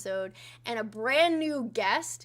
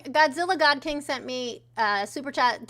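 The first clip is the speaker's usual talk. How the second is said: ordinary talk, nothing marked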